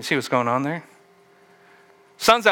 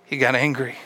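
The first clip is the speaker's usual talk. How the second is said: ordinary talk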